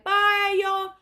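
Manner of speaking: high, drawn-out mock calling out; imitated window farewell. Singer hanging out car window to fans, imitated calling out. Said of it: "hung out the window, and he's like".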